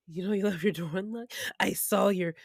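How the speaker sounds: low, creepy stalker impression; menacing O J. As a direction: drop low, casually menacing the victims. Imitating O J's menacing opener.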